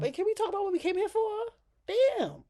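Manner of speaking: high, fast, fed-up plea; exasperated squeal. Real, not acted. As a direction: squeal it out, done with her. Exasperated outburst at Chelsea.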